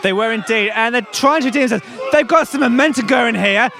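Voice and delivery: commentary lifting from chat into excitement; live play-by-play. Real, not acted. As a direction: build energy as the pull begins. Live tug of war commentary.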